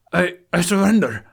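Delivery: high, loud, frightened stammer; stammering surrender. A narrator's reading. Trapper stammering his surrender under the dragon's claws. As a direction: blurt it out in panic. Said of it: "he stammers out".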